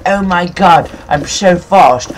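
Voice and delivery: retold thrill, drawn out; excited quote. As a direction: gush over the new speed. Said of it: "I was like".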